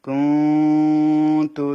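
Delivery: কুন তু chanted slowly, nun held in the nose; slow tajweed chant with a long nasal ghunnah; ikhfa nasal hold. Shown as a demonstration. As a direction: hum the nun of কুন for two counts before তু. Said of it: "নাকের বাঁশির মধ্যে নিয়ে দুই আলিফ সমান রাখতে হবে".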